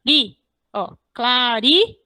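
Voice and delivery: "ri" on its own, then "claridade" split into syllables; single out "ri" within "claridade"; "claridade" broken up to show the stressed syllable; tonic syllable demo. Shown as a demonstration. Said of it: "a última sílaba tônica".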